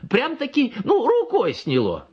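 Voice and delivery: high, slow sing-song; high gushing sing-song; delighted peasant woman. A narrator's reading. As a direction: gush about the cure. Woman praising cure.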